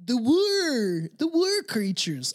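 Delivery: drawn-out spooky alien voice. Shown as a demonstration.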